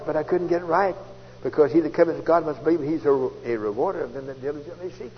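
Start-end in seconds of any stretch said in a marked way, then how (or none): none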